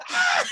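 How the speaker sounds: high, loud mock scream of kids fleeing; imitated scream. Kids see a python, then a high mock scream. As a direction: shriek like scared kids running. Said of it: "everyone's like".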